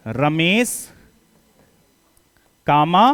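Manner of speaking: each word spaced with long pauses; slow board dictation. Shown as a demonstration. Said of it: "dictating a sentence".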